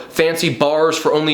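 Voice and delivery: usual speaking voice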